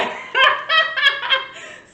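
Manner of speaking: high-pitched laugh; amused laugh. Real, not acted. Co-host laughing after imitating the pastor, high amused laugh. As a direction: laugh at your own impression.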